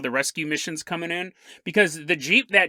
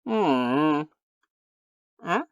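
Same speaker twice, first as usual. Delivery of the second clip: bigfoot grunting; 2 semitones lower, 4 dB quieter, much slower than usual. Acted. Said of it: "Bigfoot goes".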